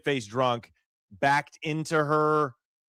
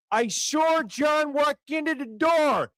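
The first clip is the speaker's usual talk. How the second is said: mock Massachusetts accent; 12 semitones higher, slower than usual. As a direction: ham up a Massachusetts cop accent, putting an r on "saw". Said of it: "It's not pronounced sore".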